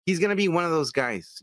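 usual speaking voice

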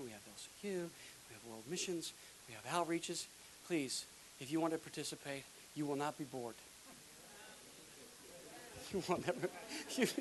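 ordinary delivery

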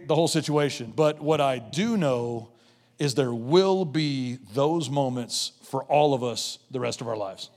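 ordinary talk

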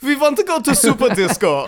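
high-pitched comic German clubber; mock German accent. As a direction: ham up a German accent, amused. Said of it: "the crew was mostly German".

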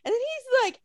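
usual speaking voice

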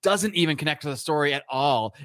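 usual speaking voice

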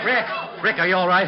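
loud, high worried call across the scene; urgent call. Acted. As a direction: call out to check he's alive. Lieutenant runs up after shooting.

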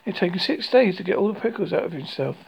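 ordinary delivery

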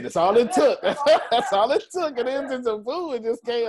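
saying it through real high laughter; laughing. Real, not acted.

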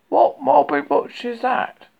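Pooh's innocent question; curious bear. Acted. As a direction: ask in a small eager bear voice.

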